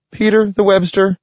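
high breathless critter voice; squirrel voice. Acted. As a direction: blurt it out like a scared squirrel. Squirrel voice continues.

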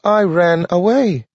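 a small eager dog, voiced high and bright-eyed; Nathaniel the dog. A narrator's reading. Dog Nathaniel relieved to find Grant awake, high dog voice. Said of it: "Nathaniel told him".

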